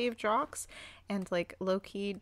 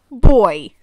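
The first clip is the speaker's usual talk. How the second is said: ordinary talk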